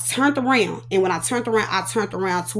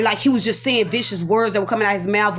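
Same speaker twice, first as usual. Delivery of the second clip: ordinary delivery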